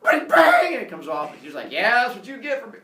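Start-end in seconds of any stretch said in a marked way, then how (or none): 0.0-0.7 loud, high snapping noise; explosive mock twang; string breaking. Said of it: "And then the string breaks". 1.7-2.8 louder, higher mock jeer; taunting retort. Teacher and pupil quarrel.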